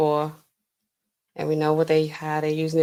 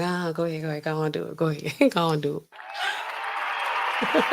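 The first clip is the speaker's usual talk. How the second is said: repeated brush-off, mind elsewhere; mock busy, distracted; 3 semitones lower, 3 dB quieter, much faster than usual. Streamer imitating a busy president approving things, distracted. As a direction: lightly imitate a preoccupied boss waving it through. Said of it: "ask him something while he busy".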